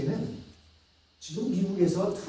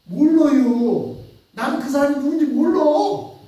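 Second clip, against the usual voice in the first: loud denial; 8 semitones higher, 8 dB louder than usual. Acted. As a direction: drag out the denial to push them off. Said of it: "베드로는 이렇게 얘기하는 거예요".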